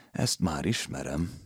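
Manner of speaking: soft, slow weary mutter; resigned. A narrator's reading. Landlady to herself at the window, soft and slow. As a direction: sigh it out, tired of it.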